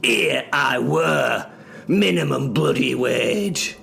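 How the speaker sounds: building up to the insult of it all; rising indignation. Acted. Narrator fuming at working for minimum wage, rising indignation.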